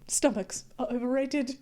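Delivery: normal talking voice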